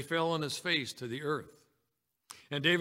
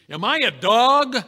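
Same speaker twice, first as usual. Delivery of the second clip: much higher, very loud indignant roar; booming giant; 8 semitones higher, 15 dB louder than usual. Acted. Giant Philistine taunting young David, booming. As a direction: boom it out like an outraged giant. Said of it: "this big giant with that booming voice".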